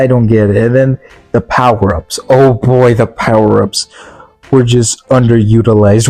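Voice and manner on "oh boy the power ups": normal talking voice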